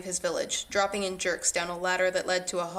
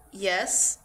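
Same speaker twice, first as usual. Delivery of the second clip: ordinary delivery, nothing marked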